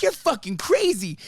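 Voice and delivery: mock outrage, laughing at her; incredulous. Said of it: "He's like".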